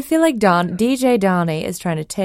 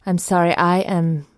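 normal talking voice

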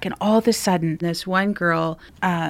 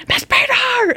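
imitated yell. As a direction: echo the distant shout playfully. Colleague imitating the distant yell.

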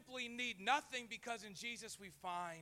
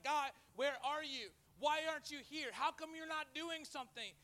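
raised, pleading cry of frustration; yelling at God; 7 semitones higher than usual. Retold or imitated. Churchgoer crying out to God in pain, raised and pleading. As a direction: shout up at God, demanding answers.

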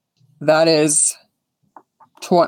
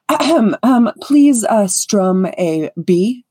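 throat-clearing, higher-pitched performer's request; self-important bard; 5 semitones higher, slower than usual. Acted. Vain bard cueing the lute player, fussily grand. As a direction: make a show of readying to sing, drawing out the last words. Moss readies himself to sing.

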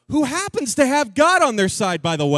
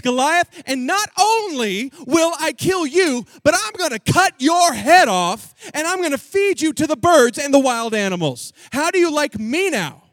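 loud, higher triumphant shout; shouted threat; 4 semitones higher, 4 dB louder than usual. Acted. David shouts his threat back at Goliath, loud and fierce. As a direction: yell it with full confidence. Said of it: "he says".

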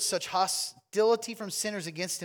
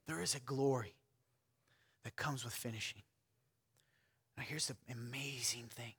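softer, awed sermon voice; hushed preaching; 4 semitones lower, 9 dB quieter than usual. Real, not acted. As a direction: drop to a soft, near-whispered reverence.

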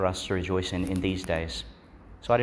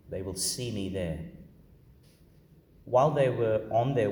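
measured, solemn scripture reading; Gospel reading. Acted. Proclaims the Gospel at Mass.

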